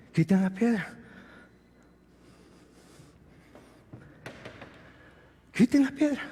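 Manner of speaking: Dios habla bajo y grave; pronúncialo con calma solemne; solemn quiet. Acted. Predicador baja la voz solemne. (voz suave y solemne de Dios)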